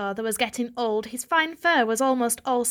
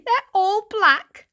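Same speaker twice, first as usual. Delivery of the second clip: slow, high frightened hush; a touch of fear; fearful; 11 semitones higher, 7 dB louder, much slower than usual. Acted. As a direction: shudder out the words. Varjak frightened.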